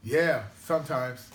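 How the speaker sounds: loud, drawn-out reply breaking into laughter; amused laughing. Real, not acted. Laughs at remark on stroller days.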